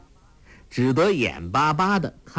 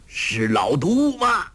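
slow, higher call into the dark; old beggar calling into the night; 6 semitones higher, slower than usual. A narrator's reading. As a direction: call out across the water. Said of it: "洪七公叫道".

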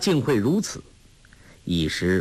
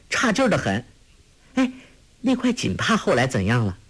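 put-on bright girl's voice, higher, eager and chatty; lively young girl; 7 semitones higher than usual. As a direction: play a quick-witted girl teasing her elders. Narrator voices Huang Rong.

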